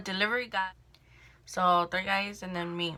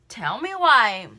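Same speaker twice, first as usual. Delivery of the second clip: outraged exclaim; 3 semitones higher, 10 dB louder, slower than usual. Retold or imitated. Retelling seeing something disgusting.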